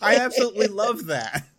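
real amused delight, laughter in the voice; laughing delight. Real, not acted. Laughing at the character.

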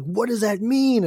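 high, slow puzzled question; confused question. Retold or imitated. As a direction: ask it baffled and drawn out. Internet fans asking, confused.

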